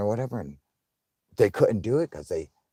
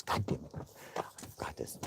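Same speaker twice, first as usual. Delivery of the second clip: softer whispered mutter to himself; whispered aside; 6 semitones lower, 16 dB quieter, faster than usual. Real, not acted. Streamer realising he forgot something, whispering. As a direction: breathe it half to yourself.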